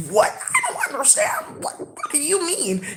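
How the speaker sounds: very high, slow, soft bewildered voice; squeaky confused voice. Retold or imitated. Imitating the confused woman, high and slow. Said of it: "that's how they talk".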